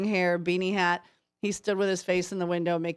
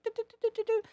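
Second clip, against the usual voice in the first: high, soft pattering "do-do-do"; light, high-pitched patting sounds; squirrel patting noise; 13 semitones higher, 4 dB quieter, much faster than usual. Retold or imitated. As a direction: imitate tiny squirrel paws at work. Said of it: "his little hands like".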